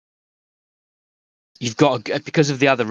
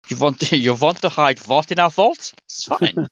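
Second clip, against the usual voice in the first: put-on lightly Swiss accent; 4 semitones higher than usual. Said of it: "a Swiss accent".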